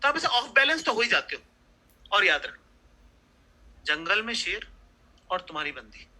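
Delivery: normal talking voice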